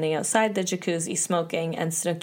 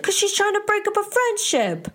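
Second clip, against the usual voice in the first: high-pitched indignant imitation; Pauly angry. Answering Snooki's question about being mean, mock angry. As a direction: hurl it back as a mock furious retort. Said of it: "the angriest you ever see him get".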